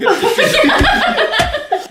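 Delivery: high, slow, loud laughing squeal; words squeezed through laughter. Real, not acted. Co-reader cracking up over the typo.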